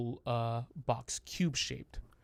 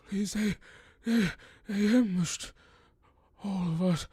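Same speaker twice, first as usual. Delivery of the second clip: put-on higher, shaken old man's voice; frightened old man; 8 semitones higher, 5 dB louder than usual. A wounded old man tells Dominic of the ambush, high and shaken. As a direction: gasp out what happened.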